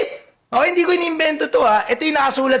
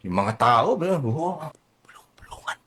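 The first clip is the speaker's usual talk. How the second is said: unvoiced gossiping whisper; 10 semitones lower, 6 dB quieter than usual. The crowd murmuring about Zacchaeus, whispered.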